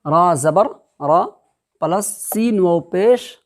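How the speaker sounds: slow, drawn recitation of letters; spelling out syllables. Shown as a demonstration. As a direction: sound out each letter slowly like a Quran teacher. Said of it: "तो देखो".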